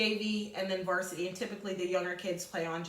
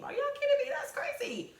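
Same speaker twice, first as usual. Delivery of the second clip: much higher, sudden are you kidding me; surprised outburst. Real, not acted. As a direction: break off to react in real disbelief. Teacher reacts to story.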